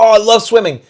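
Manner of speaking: high, loud swimming fan; mock enthusiasm. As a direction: gush loudly, imitating eager people. Voices keen swimmers in a story.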